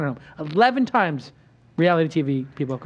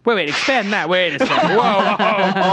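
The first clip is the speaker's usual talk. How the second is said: real laughter through the words; laughing disbelief; 3 semitones higher, 3 dB louder, slower than usual. Real, not acted. Host stopping guest after a bold prediction, laughing. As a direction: halt the guest, cracking up in amazement.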